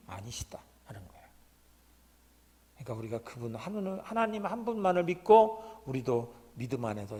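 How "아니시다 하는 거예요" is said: whisper. Real, not acted. Preacher telling the congregation, in a whisper. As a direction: drop to a hushed whisper. Emphatic close of sermon point.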